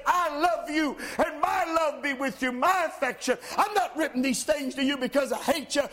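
earnest preacher's appeal; fervent preaching. Acted. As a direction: press the point home to the church. Preacher closing exhortation.

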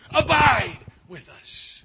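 loud, slow, drawn-out plea; pleading call. Retold or imitated. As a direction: stretch out a loud, slow appeal.

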